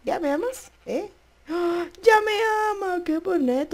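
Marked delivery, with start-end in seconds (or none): 1.5-3.7 very high, loud delighted cry; excited triumph. Real, not acted. Gamer thrilled the horse finally loves her. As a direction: celebrate the win.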